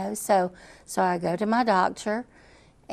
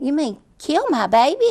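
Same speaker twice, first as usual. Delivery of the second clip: louder, higher, KILL rising in disbelief; mother confronting the doctor, outraged and incredulous; outraged question; 6 semitones higher, 7 dB louder than usual. Retold or imitated. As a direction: throw his words back at him in shock. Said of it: "I just kept saying that".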